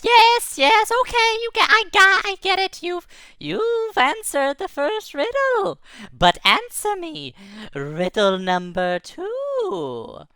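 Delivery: high, flustered tiny creature, slowing at the end; a flustered magic spark conceding; squeaky little light voice. Acted. Ball of light admitting the riddle was answered.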